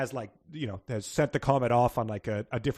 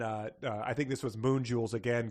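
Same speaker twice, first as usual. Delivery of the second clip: normal talking voice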